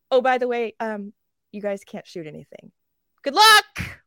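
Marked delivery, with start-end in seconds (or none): 3.2-4.0 high, loud mocking good luck; sarcastic good luck. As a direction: sneer it like a useless blessing. Mocking the commanders' send-off.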